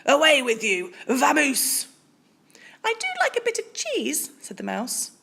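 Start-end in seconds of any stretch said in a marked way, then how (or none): 0.0-1.9 loud gruff shooing. A narrator's reading. Bear shooing the mouse away, loud. 2.8-4.3 much higher squeaky mouse; a small bright-eyed mouse piping up; tiny mouse voice. Acted. Polite mouse asks Bear for cheese, squeaky high.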